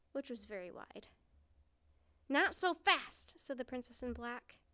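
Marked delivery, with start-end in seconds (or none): 2.3-3.4 higher, louder, slow heroic challenge; commanding challenge. A narrator's reading. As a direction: halt the villain boldly.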